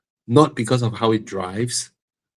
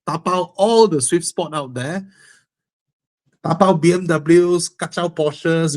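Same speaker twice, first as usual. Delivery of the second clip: hyped up; 6 semitones higher than usual. Real, not acted. Hyping up aggressive driving.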